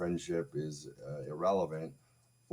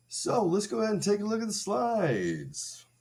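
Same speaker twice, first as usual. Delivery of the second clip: much higher, bright host voice; mock presenter voice; 6 dB louder than usual. Starting the slide show, mock presenter voice. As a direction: play a chirpy show host.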